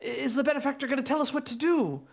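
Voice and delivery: high, pleading villager voice; desperate townsperson. Acted. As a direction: beg for help, voice climbing.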